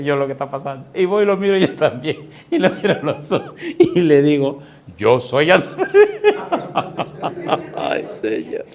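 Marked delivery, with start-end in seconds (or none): 2.5-8.6 talking through laughter; words stretched out by real laughter; laughing. Real, not acted. Preacher retelling his cheeky reply to the possessed man, laughing.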